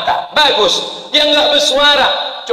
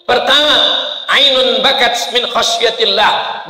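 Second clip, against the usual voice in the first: normal talking voice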